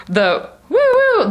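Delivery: high slow woo woo siren; siren imitation. Spotting the police car, high slow siren woo woo. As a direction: sing the siren high and drawn out.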